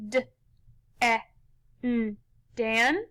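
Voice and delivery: D, E, N as separate short letter sounds, then blended into one syllable; sounded out. Shown as a demonstration. Teacher tapping out the first syllable for pupils, sounded out. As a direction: tap out each sound of "D, E, N", pause between, then say "Dan". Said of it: "let's tap this one out".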